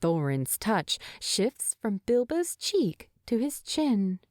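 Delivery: ordinary delivery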